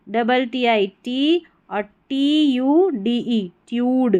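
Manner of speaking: usual speaking voice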